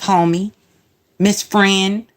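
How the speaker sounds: ordinary talk, nothing marked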